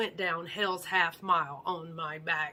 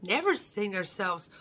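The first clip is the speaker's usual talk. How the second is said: usual speaking voice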